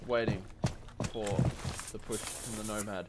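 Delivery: usual speaking voice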